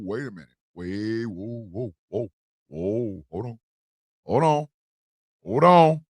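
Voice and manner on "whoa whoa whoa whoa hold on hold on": ordinary talk, nothing marked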